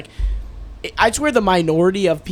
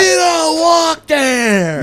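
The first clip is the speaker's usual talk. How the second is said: high, loud, drawn-out scolding; mock aggressive yell; 11 semitones higher, 6 dB louder, much slower than usual. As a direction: bellow like an overreacting guard. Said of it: "react with the most aggressive".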